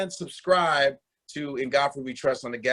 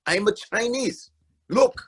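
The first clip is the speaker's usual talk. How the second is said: mock Chinese claim; 7 semitones higher than usual. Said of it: "And I go".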